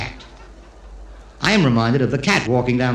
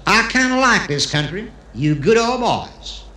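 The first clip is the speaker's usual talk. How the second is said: hammed-up Texan visitor; Texan accent, good-old-boy style; 3 semitones higher than usual. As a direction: play a Texan with a Texas twang. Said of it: "the Texan who comes to Israel".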